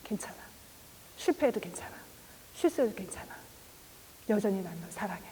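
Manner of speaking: soft unvoiced whisper. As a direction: whisper the Lord's comfort. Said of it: "그때 주님 말씀하실 거예요". (주님의 위로를 속삭이듯)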